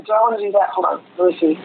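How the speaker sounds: ordinary talk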